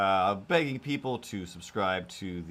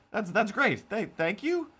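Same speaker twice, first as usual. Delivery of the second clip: squeaky thanks; 10 semitones higher than usual. Pretend.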